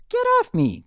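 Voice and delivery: a squealing little unicorn yelling get off; high cry. Acted. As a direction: shriek it at her brother. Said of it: "cried the creamy orange unicorn".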